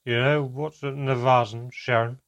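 loud, slurred and careless; tipsy, loud and unengaged; drunken slur. A narrator's reading. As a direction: slur it like she's had too much wine. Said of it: "replaced by an unengaged slur".

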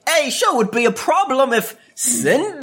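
hammed-up veiled threat; mock villain threat. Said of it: "he does that thing of".

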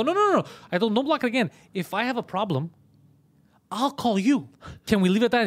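high, loud frantic no no; frantic pleading. Retold or imitated. Customer begging shop guy not to block, frantic. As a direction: stop him in a panic.

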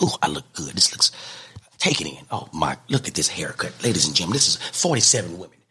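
low, smug self-admiring purr; vain haircut brag. Retold or imitated. Comedian admiring his haircut on camera, low smug purr. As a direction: admire yourself in the mirror, low and pleased. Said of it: "I would look into the camera like".

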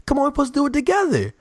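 high, whiny mock complaint; mock offended. The Sports Nation guy scolding the speaker over the peace sign, in a whiny high voice. Guy insisting on a joint pose.

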